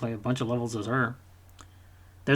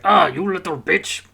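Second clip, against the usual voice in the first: loud snarl of a Russian soldier, high and slow; angry soldier; 5 semitones higher, 8 dB louder, slower than usual. Retold or imitated. Soldier yelling at the child he is chasing, hammed-up snarl. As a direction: bark it like the soldier lunging at a kid. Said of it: "he's like".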